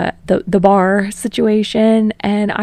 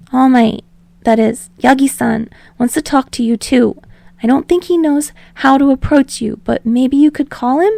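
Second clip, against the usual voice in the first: higher, louder eager Deku voice; rambling; 5 semitones higher, 4 dB louder than usual. Acted. Deku rambling to Bakugo on the phone, higher and eager. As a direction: babble on nervously without a breath.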